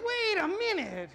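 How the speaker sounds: high, slow, mock-puzzled; mock surprise. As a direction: drag it out as you catch on. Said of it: "And I was like".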